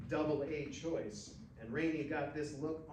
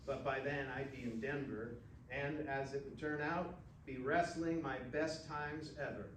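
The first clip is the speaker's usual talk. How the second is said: plain reading voice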